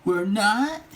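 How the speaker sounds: high, surprised we're not; puzzled question. A narrator's reading. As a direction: ask it back in disbelief. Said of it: "Carl frowned".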